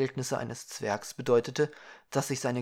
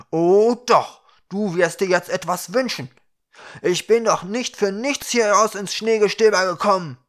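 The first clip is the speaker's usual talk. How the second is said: loud, raised, indignant shouting; angry insistence; 7 semitones higher, 11 dB louder than usual. A narrator's reading. Ukra insisting the dwarf make a wish, angry shouting. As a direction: overrule him at full voice.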